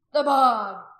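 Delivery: loud, forceful chant-like cry; roared rallying cry; crowd's shout. A narrator's reading. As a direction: shout agreement with the mob.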